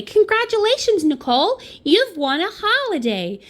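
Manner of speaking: high-pitched, gushing American phone voice; hammed-up American prize announcer; excited American accent. Caller telling Nicole she won a holiday, excited American accent. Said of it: "that was my American version".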